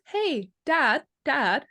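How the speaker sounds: put-on high child calling dad; lightly voiced child tugging at dad. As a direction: call out like a small kid. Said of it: "your child goes".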